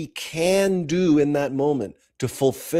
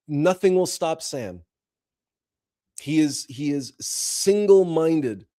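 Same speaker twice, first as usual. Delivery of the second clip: ordinary delivery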